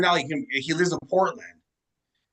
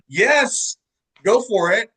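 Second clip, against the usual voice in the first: eager excited yes; 9 semitones higher, 6 dB louder, slower than usual. Retold or imitated. Eager yes to singer retold.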